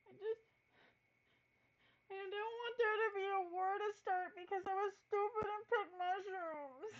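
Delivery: almost crying over her mistake; tearful. Acted. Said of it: "she's like".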